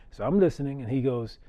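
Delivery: ordinary talk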